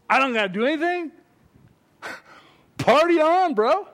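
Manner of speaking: high, loud party-dude whoop; gleeful mock party shout; slacker student. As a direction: crow it like a student off the hook. Acting a slacker student celebrating.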